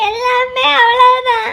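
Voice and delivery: slow, drawn-out wail; crying. Acted. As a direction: wail it out through tears. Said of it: "எதுக்கு என் மச்சி அழுக வச்ச". (அழுது புலம்பும் குரல்)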